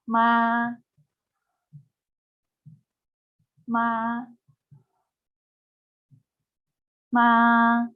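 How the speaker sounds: "Ma" held flat and level, each drawn out; first tone on ma. Shown as a demonstration. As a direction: keep "Ma" on one even pitch. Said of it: "let's practice the first tone with different initials".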